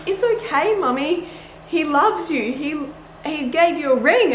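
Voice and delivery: put-on high-pitched little child consoling a parent; Layla. Daughter wiping mum's happy tears, high comforting child voice. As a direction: imitate a small child's sing-song comfort. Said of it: "Layla was ... and she's like".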